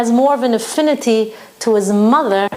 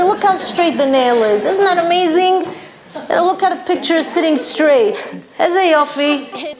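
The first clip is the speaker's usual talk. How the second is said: higher, self-satisfied husband boasting about his nail; proud husband showing off his handiwork; smug husband fishing for praise; 7 semitones higher than usual. Retold or imitated. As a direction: preen over a straight nail. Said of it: "he's gonna say".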